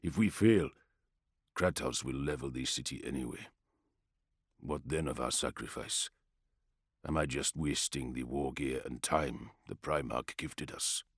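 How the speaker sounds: low, doubtful soldier; quiet warrior voice. Acted. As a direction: murmur the worry, subdued. Arai voices his doubt.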